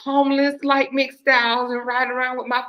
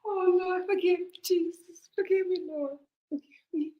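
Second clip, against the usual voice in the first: tearful plea; 5 semitones higher, 4 dB quieter than usual. Real, not acted. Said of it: "I'm crying right now".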